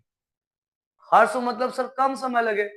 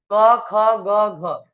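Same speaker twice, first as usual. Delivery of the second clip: slow listing; 5 dB louder, much slower than usual. Shown as a demonstration. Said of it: "listing options slowly".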